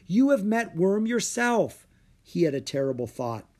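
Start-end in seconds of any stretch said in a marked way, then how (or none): 0.1-1.9 high, loud insistence; crying out. A narrator's reading. Son reminding mother of his dragon, crying out. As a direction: argue back in desperation. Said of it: "he cried".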